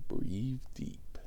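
soft breathy whisper to himself; whispered self-calming. Real, not acted. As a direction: whisper it to calm yourself down. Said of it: "And I'm like".

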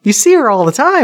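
high, incredulous "What?"; surprised. Retold or imitated. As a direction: act shocked at the news. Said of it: "He's like".

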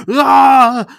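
loud, high "ah" scream; dramatic yell. Acted. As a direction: cry out big for the cliffhanger. Imagined character cries out before ending music.

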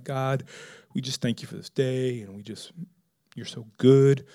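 low, hushed, holy-sounding prayer; mock pious prayer. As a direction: imitate a reverent, religious prayer softly. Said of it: "say a prayer that's real, like, holy and religious".

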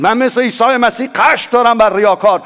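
a pompous mock boast of holy anger; mock self-righteous. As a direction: sneer it like a self-righteous believer. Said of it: "نگید".

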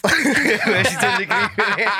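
high, slow words breaking into laughter; real laughing. Real, not acted. Telling friends about his aunt's outburst, laughing.

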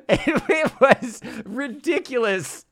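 high, slow words through laughter; laughing. Real, not acted. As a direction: say it while cracking up. Laughing over the terrible accents.

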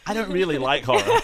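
loud, high-pitched, amused mock confession; laughing echo. Player teasing the table about everyone disliking horror, laughing. All echoing the same confession.

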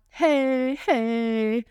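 normal talking voice

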